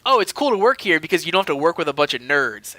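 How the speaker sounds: normal talking voice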